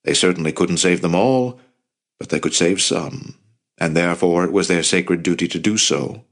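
ordinary delivery, nothing marked

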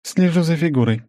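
woman's voice. Acted. Svetlana voiced higher.

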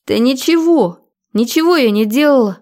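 high, pleading female protest; distressed, wailing denial; near tears. A narrator's reading. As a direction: protest as tears start to come. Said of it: "Она начала реветь".

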